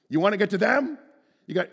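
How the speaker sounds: hammed-up movie-cowboy threat; mock Clint Eastwood. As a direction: play a gunslinger daring them. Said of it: "he wouldn't say it in a Clint Eastwood accent".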